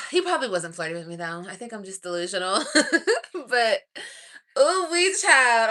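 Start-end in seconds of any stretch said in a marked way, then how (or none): 4.6-5.7 swooning. Real, not acted. Swooning over him.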